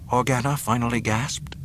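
plain reading voice

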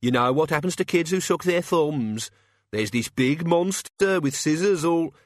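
put-on high, drawn-out childish taunting; singsong it to scare and tease; jeering. A narrator's reading. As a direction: playground bully's nyeh-nyeh mockery. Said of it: "Cat's Eye jeered".

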